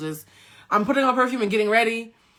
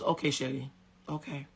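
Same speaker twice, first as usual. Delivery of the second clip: soft, low, brief acceptance; subdued mother; 5 semitones lower, 9 dB quieter than usual. Retold or imitated. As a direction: imitate mom giving in quietly. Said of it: "usually has a lot to say".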